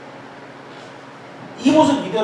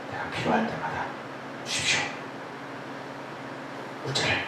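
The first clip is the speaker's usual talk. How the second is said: hushed, much softer breathy request; whispered prayer; 4 semitones higher, 13 dB quieter than usual. Retold or imitated. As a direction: whisper it to God. 속삭이는 기도. (속삭이듯 조용히 기도)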